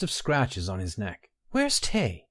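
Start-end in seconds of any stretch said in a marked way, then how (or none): 1.5-2.3 put-on worried young woman. Baynara asks urgently.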